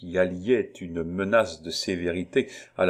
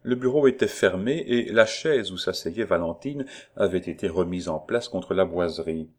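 plain reading voice

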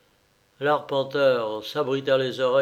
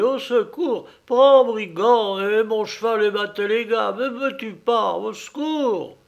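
high pleading panic, slowing at the end; panicked cry; 8 semitones higher, 6 dB louder than usual. Acted. Coachman crawling off into the woods, high frightened pleading. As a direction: beg the robber in terror. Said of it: "cria-t-il".

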